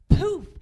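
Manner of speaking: loud burst of a mock explosion; sudden loud poof; poof sound. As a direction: blurt it out loud like a sudden flame. Pants burst into flames.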